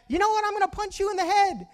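high, held-back mimicked yell; echo heard back. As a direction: throw the threat back like an echo. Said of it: "then he heard back".

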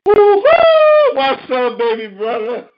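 loud, high whoop into a hearty hello; thrilled, high-pitched shout-out; excited greeting. Real, not acted. Host excitedly greets caller friend.

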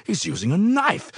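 high, startled realization; sudden alarm. A narrator's reading. Helper feeling a stab in fight, alarmed. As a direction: let it leap up in alarm. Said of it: "flashed through my mind".

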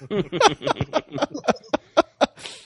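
high giggle; laugh. Real, not acted. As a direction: chuckle at own joke. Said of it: "tree huggers".

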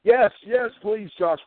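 ordinary talk, nothing marked

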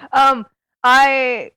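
stammered start at a yell. Real, not acted. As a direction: talk too loudly while searching for words. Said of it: "Stop yelling".

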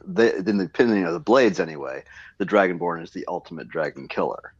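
ordinary talk

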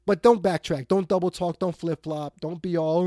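normal talking voice

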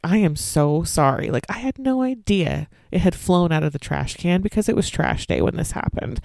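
usual speaking voice

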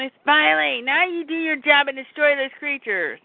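half-scream the orders, imitating an irate villain; mock scream. Lex still screaming at his henchman, mock scream. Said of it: "Lex is still screaming".

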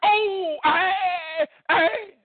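high crying hollers of spectators; imitating a cheering crowd. Crowd cheers like boxing match.

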